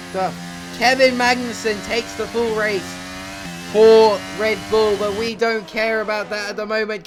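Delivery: fast-flowing, urgent racing commentary; live play-by-play. Real, not acted. Gamer calling his own final-lap battle to viewers, excited play-by-play.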